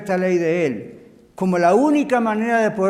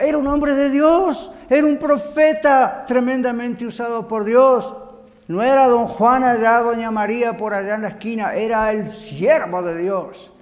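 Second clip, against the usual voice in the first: raised, higher preacher voice; emphatic preaching; 5 semitones higher, 5 dB louder than usual. Real, not acted. Preacher telling the congregation of a prophet, emphatic. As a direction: drive each point home loudly.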